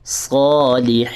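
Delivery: صالحین with a thick ص and a long vowel; heavy long sad. Shown as a demonstration. Teacher modelling the heavy sad. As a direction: give صالحین a heavy ص and stretch it. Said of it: "صاد کو ہم نے موٹا کرنا ہے اور لمبا بھی".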